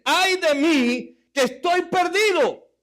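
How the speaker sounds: high, loud, slow wail of woe; stretched lament, high and loud; anguished cry. Acted. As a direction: cry it out like the prophet. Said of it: "Entonces grité".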